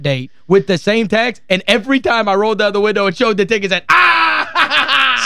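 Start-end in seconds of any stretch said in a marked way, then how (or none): 3.9-5.2 a laughing mock yell, higher; mock exasperated yell. Host retelling cops seeing the same ticket, laughing yell. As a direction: laugh through a mock shout of "Ah". Cop reacting to shown ticket.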